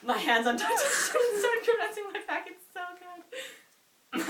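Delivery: high and very slow, strained by laughter; squeaking out the words in a laughing fit. Real, not acted. Reading the cringey love scene, helpless laughter. Reader cracking up.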